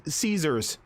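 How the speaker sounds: ordinary talk, nothing marked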